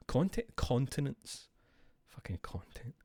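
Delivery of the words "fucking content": whispered aside; unvoiced muttered correction. Real, not acted. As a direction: hush it under your breath. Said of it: "whispered self-correction aside".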